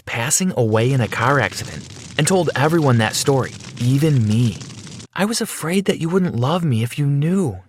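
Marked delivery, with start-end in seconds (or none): 5.1-7.6 higher-pitched, pleading self-defense; understated defensive confession; defensive plea. A narrator's reading. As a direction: plead your case, mildly upset. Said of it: "she said in her defense".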